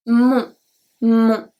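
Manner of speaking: usual speaking voice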